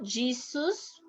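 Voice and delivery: "Jesus" starting with a soft English G sound; English pronunciation of Jesus. Shown as a demonstration. Teacher shows students the name to avoid, English J pronunciation. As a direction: say "Jesus" with English J, as GEE-zus.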